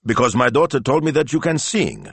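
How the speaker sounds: high, emphatic explanation; businesslike. A narrator's reading. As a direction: get straight to the point. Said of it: "get right down to business".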